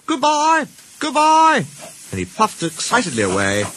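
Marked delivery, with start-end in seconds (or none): 0.1-1.7 high, cheery goodbye call; calling farewell. Acted. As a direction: call it out twice, pitched right up. Duck calling farewell as he leaves.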